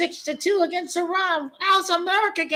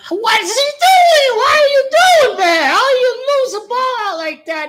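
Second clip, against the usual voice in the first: high, loud outburst; exasperated shouting; 7 semitones higher, 8 dB louder than usual. Real, not acted. Streamer berating a player who lost the ball, exasperated shouting. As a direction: yell in disbelief at the player.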